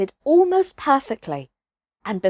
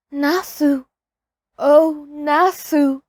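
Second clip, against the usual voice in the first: drawn-out, yearning call of a lost son's name; longing call; 5 semitones higher, slower than usual. A narrator's reading. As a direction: call him slowly by the name, aching. Mother calling lost son's name.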